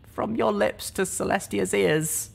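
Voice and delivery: barely holding back tears; whimpered. Acted. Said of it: "I whimpered".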